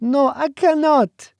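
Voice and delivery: slow, tearful high-voiced plea; sobbing. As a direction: beg through tears, barely able to speak. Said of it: "she sobbed".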